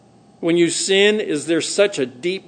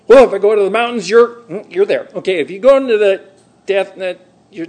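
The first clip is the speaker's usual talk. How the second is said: loud, higher, hurried tumble of words; worked-up outburst; 3 semitones higher, 7 dB louder than usual. Acted. Preacher acting out David searching the extremes, worked up. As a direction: burst out, tripping over the thought. Acts out David's explosive, weird excitement.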